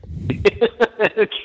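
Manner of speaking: high run of chuckles; amused laugh. Real, not acted. Guest enjoys the clown banter, amused laugh. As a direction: chuckle along at the teasing.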